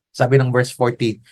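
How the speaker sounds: usual speaking voice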